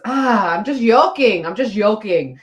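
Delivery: playful mock South American Spanish accent; Hector's Spanish accent. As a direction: mimic a Spanish accent, exclaiming "yoking" for "joking". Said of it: "there's no J in Spanish. It's yo".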